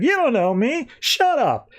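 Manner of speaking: high-pitched retold outburst; mock angry retort. Barry to a taunting Reverse Flash, mock angry. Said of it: "you sure are angry".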